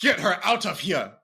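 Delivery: put-on high, loud African-accented order; hammed-up outrage in a stock African accent; mock African accent. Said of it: "They're gonna be like".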